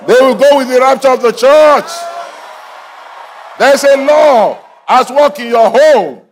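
high, shouted proclamation; full-force preaching. Real, not acted. Preacher proclaims blessing over homes, high and shouted. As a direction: declare it over the congregation in a sustained shout.

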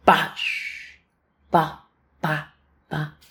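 spaced, percussive "pa" taps; imitated hammer blows. Storyteller resumes hammering with care, spaced knocking noises. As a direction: tap out careful hammer hits by voice.

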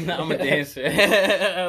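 loud, laughing through the words. Real, not acted. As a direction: crack up while saying it. Joking, breaking up laughing.

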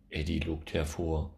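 plain reading voice

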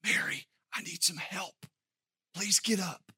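soft unvoiced whisper. Martha quietly whispering to her sister for help. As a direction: lean in and whisper the plea. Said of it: "whispered in her ear".

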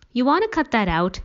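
stern parent cutting in sharply; snapped. A narrator's reading. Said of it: "he snapped".

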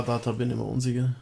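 ordinary delivery

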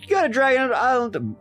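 mock skeptical scientist. Skeptical scientist voiced mockingly.

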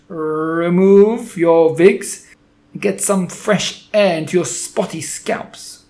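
put-on very slow, loud foreign-accented witch, wigs said like vigs; harsh accented witch yelling an order; witch's shout. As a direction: spit out the rolled R in Remove. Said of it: "she shouted".

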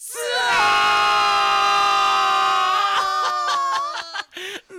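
long, high drawn-out cry; stunned shout. Acted. Narrator cries out at the boss's reaction, long and high. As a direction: gasp out in disbelief.

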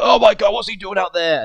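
high, loud hammed-up astonishment; mock shock. Commentator seeing a surprise entrant, hammed-up high loud shock. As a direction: play the stunned commentator big.

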